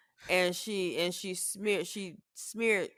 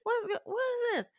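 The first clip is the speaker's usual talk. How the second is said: much higher, rushed alarm; alarmed confusion; 4 dB quieter, faster than usual. Retold or imitated. Mother acting her alarm at the smell, high-pitched. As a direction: sound baffled and panicked. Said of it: "And I was just like".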